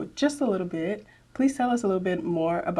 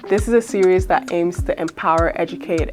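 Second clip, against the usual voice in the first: upbeat, louder announcer read over music; podcast intro read; 7 dB louder than usual. Acted. Host opening the podcast episode for listeners, upbeat announcer read. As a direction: welcome the listeners like a show host.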